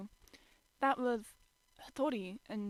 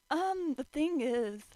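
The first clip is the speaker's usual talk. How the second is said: slow, sheepish stalling; nervous hesitation; 5 semitones higher, 4 dB louder, much slower than usual. Acted. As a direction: drag it out awkwardly. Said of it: "grinned nervously".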